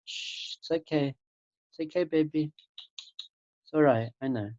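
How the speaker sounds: soft, hushing reassurance; gentle acted lullaby-like calming; soothing a baby. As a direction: shush and comfort a fussing baby. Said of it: "treat your mind like a baby".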